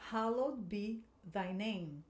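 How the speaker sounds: slow, careful pronunciation; teacher modelling for students to repeat; slow model line. Shown as a demonstration. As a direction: read it slowly for echoing. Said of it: "Agora aqui essa parte".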